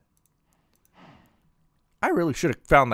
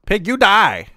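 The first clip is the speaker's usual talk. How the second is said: battle shout; 9 semitones higher, 9 dB louder than usual. Acted. Attacking the pig in game.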